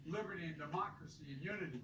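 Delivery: stirring speech building to a shout; rally the crowd, end on a rousing call. Acted. Rally speech continues, building to close.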